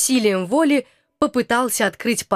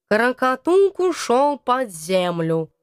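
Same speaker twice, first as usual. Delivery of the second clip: ordinary delivery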